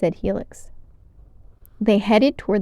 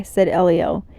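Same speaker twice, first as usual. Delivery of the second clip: ordinary delivery, nothing marked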